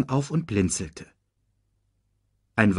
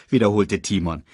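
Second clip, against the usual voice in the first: plain reading voice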